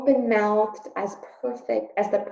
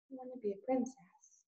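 quiet wish; 4 semitones higher, 12 dB quieter than usual. Acted. Meg repeats her wish.

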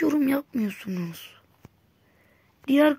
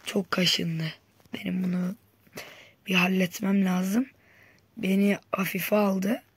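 usual speaking voice

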